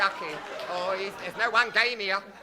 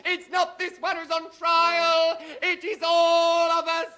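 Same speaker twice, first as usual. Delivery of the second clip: rousing oration; 7 semitones higher, 3 dB louder than usual. Acted. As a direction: preach it to the jury. Rousing courtroom oratory.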